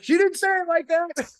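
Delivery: high-pitched mock protest; put-on high voice, imitating; comedy-bit impression. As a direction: pitch it up as a comic objection. Acting out comedian's courtroom bit.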